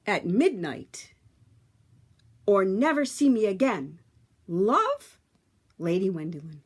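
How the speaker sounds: put-on lively read-aloud for children; storybook narration. A narrator's reading. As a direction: tell it like a bedtime story to a class.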